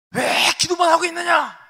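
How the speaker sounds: high, loud rebuking shout; God shouting. Acted. God yelling at Moses stuck in prayer. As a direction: yell it at the one who only prays. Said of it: "막 소리 지르시는 거죠".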